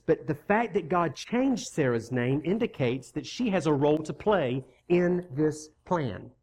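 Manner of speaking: ordinary delivery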